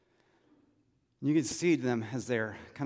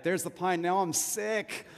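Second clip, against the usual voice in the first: higher, drawn-out mock whine; carsick kid complaint; 6 semitones higher than usual. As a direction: act out a carsick complaint, dragging the last words. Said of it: "And I'd be like".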